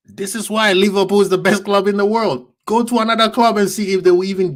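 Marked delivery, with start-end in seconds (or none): none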